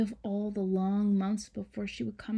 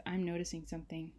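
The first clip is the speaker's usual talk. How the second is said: ordinary delivery, nothing marked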